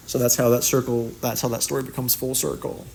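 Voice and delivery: ordinary talk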